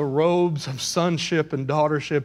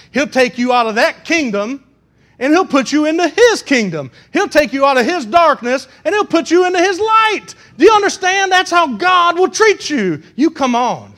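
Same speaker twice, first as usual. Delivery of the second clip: loud, excited pulpit delivery; high-pitched preaching; 11 semitones higher, 9 dB louder than usual. Real, not acted. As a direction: press the good news on them, high and loud. Preaches at full force.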